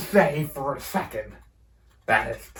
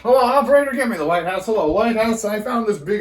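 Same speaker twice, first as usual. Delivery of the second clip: loud, high hello to the operator; calling out; 6 semitones higher, 5 dB louder, much faster than usual. Pretend. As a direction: bark it loud down the line. Calling out on the phone.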